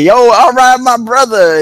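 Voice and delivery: loud, high, overdone "Yo, all right, my brother"; mock a try-hard guy greeting; fake street greeting. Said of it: "if you say".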